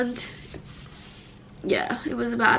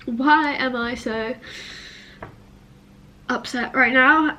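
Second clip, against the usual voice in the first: tearful; 4 dB louder, slower than usual. Real, not acted. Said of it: "excuse the sniffing".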